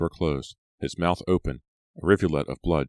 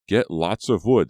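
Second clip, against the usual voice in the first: louder, slow call across the woods; shouted; 5 semitones higher, 8 dB louder than usual. A narrator's reading. Advisor shouting after the kids heading off, slow and louder. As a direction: call it out after them, drawn out. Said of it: "their advisor shouted".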